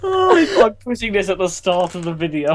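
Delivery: high, laughing outburst settling down; amused, breaking high at the start; laughing delight. Real, not acted. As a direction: say it while recovering from laughter. Delighted after cracking up laughing.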